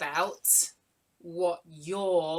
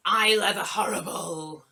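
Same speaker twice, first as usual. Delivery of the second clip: louder playful pirate name-call; 7 dB louder than usual. Pretend. Host names a young viewer as a pirate, playful pirate call. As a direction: announce it like a mock pirate.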